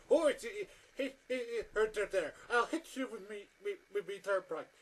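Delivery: high, loud mock Irish accent. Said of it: "in an Irish accent going".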